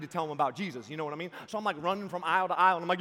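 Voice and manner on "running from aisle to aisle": usual speaking voice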